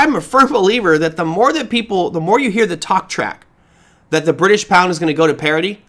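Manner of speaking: usual speaking voice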